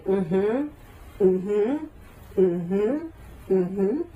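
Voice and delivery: imitated dutiful mm-hmms, each rising at the end; placating listener. Husband hearing nonstop talk on a train, mock dutiful agreement. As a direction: keep humming agreement without joining in. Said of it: "her husband, just kept saying".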